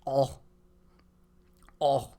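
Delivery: short puffed-up oh sounds, rising; puffed-up pufferfish. Pretend. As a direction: puff out each oh like a pufferfish. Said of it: "the puffer fish".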